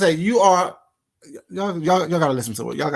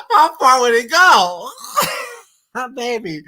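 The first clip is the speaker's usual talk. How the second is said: laughing punchline; 4 semitones higher, slower than usual. Real, not acted. Reactor relishing the cooking insult, laughing. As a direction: stretch the punchline out through laughs.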